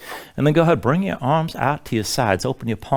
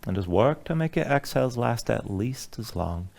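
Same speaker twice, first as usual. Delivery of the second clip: guided meditation; 4 semitones lower, 5 dB quieter than usual. Acted. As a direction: ease them into calm breathing. Leads a relaxation breathing sequence.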